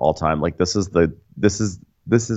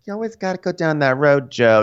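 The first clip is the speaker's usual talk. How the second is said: much higher, scolding wife; 9 semitones higher than usual. Retold or imitated. Wife scolding Joe over his jokes, much higher. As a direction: needle him in a high voice. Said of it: "She's like".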